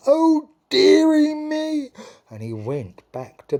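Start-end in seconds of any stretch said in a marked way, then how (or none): 0.1-1.9 very high, loud, slow whine; king whining to the queen. Acted. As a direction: whine like a spoilt child. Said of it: "The king whined".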